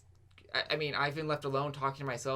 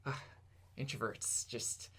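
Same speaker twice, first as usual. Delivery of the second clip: normal talking voice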